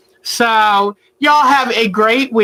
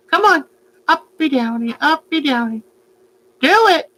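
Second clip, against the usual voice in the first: high, eager coaxing; high coaxing pet talk; coaxing the bird; 6 semitones higher than usual. Acted. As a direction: urge the bird on brightly.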